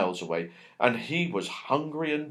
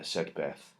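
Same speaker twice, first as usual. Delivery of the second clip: ordinary delivery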